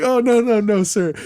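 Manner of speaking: high, loud panicked denial; flustered backpedal. Retold or imitated. Embarrassed young fan backpedalling to a rock star, flustered. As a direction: scramble to take it back.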